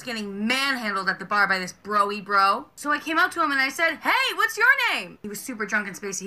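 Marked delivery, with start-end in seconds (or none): 4.0-5.2 high, slow and sweet; toddler-innocent question; sweet customer service voice. Retold or imitated. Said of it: "customer service voice".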